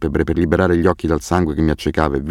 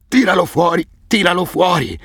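very high, frantic howl; wounded man howling to get it out; howling in agony; 11 semitones higher than usual. A narrator's reading. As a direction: scream it out in pain and panic. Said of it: "ululava Asef".

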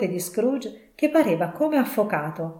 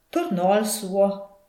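ordinary delivery, nothing marked

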